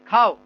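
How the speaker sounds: emphatic falling খাও; single stressed word, খাও. Shown as a demonstration. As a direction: give খাও firm weight. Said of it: "এটা জোর দিয়ে বলেন".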